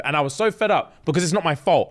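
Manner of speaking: ordinary talk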